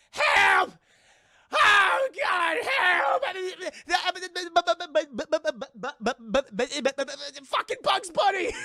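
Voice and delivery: high-pitched panicked screaming with frantic stuttering; hammed-up shrieking terror; Porky Pig. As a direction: scream for help like a stammering cartoon pig. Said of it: "Porky Pig's like".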